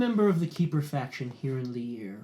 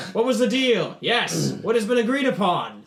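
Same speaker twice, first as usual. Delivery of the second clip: rowdy yell, much higher and louder; yelling crowd; 8 semitones higher, 10 dB louder than usual. Acted. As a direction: shout the question from the crowd. Barbarians yell back and forth.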